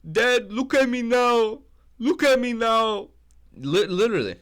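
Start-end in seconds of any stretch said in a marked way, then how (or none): 0.1-3.1 much higher, louder, slow put-on voice; mock tearful shout. Imitating Pantoja calling to his dad, high and slow. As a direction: cry it out high and drawn-out.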